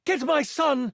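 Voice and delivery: high, urgent queen's command; lightly acted yell, pitched high. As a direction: call it out high, as a desperate mother. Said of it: "Quickly, she yells".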